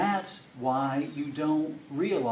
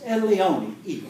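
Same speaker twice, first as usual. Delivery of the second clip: lightly voiced commanding Italian mom, louder and higher; loud, higher call on the name; Italian mother; 6 semitones higher, 6 dB louder, slower than usual. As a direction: call the boy to the table like a bossy mama. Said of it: "she's a large Italian woman".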